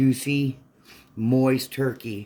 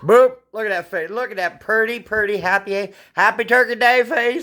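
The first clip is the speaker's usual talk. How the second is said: higher and louder, building with each word; 8 semitones higher, 9 dB louder than usual. Real, not acted. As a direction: dote on her with rising delight. Mom fussing over her child.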